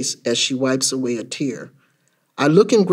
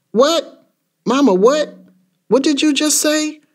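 high-pitched disbelieving questions; shocked child; 10 semitones higher than usual. Acted. Child hearing from Mama that Daddy died, shocked. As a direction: ask in stunned disbelief, voice shooting up.

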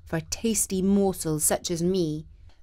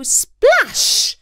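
splash; 7 dB louder than usual. A narrator's reading. Narrator splash effect.

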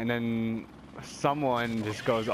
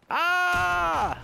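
startled yelp; 4 dB louder than usual. Real, not acted. Startled mid-sentence.

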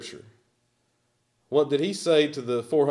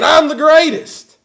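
higher and louder brash boast; Muhammad Ali boast; 11 semitones higher, 9 dB louder than usual. Retold or imitated. Preacher imitating Muhammad Ali's famous brag, higher and louder. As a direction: crow it out like the champ. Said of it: "He was always saying".

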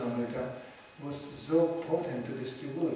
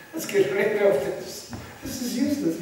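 higher, louder imitation of fed-up followers; acted-out grumbling, pitched up; mock griping; 5 semitones higher, 4 dB louder than usual. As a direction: complain in exaggerated exasperation. Acts out devotees griping about the farm.

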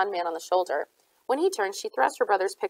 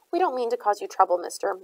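usual speaking voice

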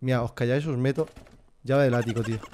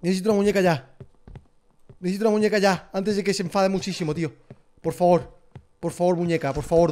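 higher, urgent begging; anxious pleading; 4 semitones higher than usual. Real, not acted. Streamer begging the game for a doll before she gets angry. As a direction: beg nervously for a doll.

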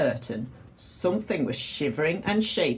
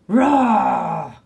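loud, drawn-out roar; imitated roar; 3 semitones higher, 8 dB louder than usual. A narrator's reading. Captain describes the noise he heard, imitated roar. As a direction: roar the word out long.

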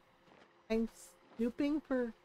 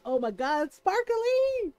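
high, squealy "sparkly!"; mock fangirl squeal; squealing delight; 6 semitones higher than usual. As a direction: squeal like a dazzled fan.

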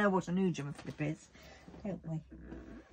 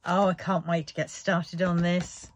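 ordinary talk

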